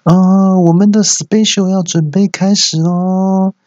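high, loud hostess announcement; high, slow MC hostess voice; microphone announcer. Acted. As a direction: announce the show with long drawn-out endings. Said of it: "这边麦克风说".

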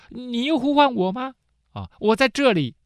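put-on eager boy. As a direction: answer brightly like a faithful little boy. The boy runs to Eli.

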